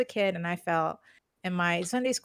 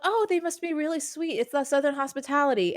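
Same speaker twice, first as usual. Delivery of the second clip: naive admiring quote; 6 semitones higher than usual. Retold or imitated. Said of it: "they're like".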